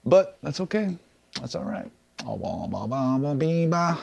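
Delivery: normal talking voice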